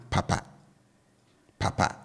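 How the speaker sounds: "papa" with both syllables kept low. Shown as a demonstration. Teacher showing learners the second Twi word, low-low tone on "papa". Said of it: "I bring the tone on both syllables down".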